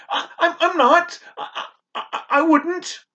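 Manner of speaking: high, stammering frightened denial; boy accused of greed, stammering. Acted. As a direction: stutter out the denial in fear.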